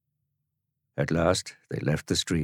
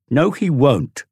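put-on high, louder boyish cut-in; cutting in; 10 semitones higher, 8 dB louder, slower than usual. Tom cutting in on Dorian, high loud refusal. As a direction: slam the idea shut. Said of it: "Tom cut in".

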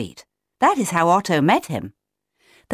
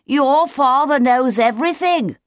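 high squealed repeat; squealed back; 7 semitones higher, 4 dB louder than usual. A narrator's reading. Leo repeating it for Otto, squealing. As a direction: squeal it back across the gap. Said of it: "Leo squealed back".

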